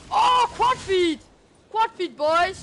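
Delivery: loud, high whoop of triumph; excited shout. Real, not acted. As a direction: yell it out like you just won big. Calling out quad feed to viewers.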